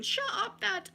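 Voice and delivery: put-on sudden high, cheeky kid retort; bratty boy. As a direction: fire it back like a spoilt brat. Bratty boy snaps at father.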